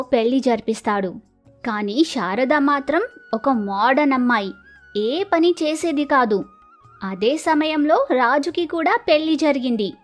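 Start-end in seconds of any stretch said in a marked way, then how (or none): none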